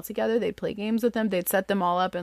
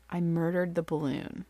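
ordinary talk

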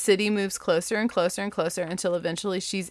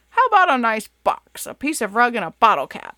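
louder, hearty father goat offer; 3 semitones higher, 6 dB louder than usual. Acted. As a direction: tempt him like a proud dad. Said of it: "asked Father Goat".

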